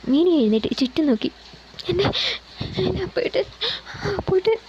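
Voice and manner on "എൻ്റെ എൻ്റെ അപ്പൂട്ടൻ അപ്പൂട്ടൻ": crying lament. A narrator's reading. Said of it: "അവൾ കരഞ്ഞുകൊണ്ട് പുലമ്പി".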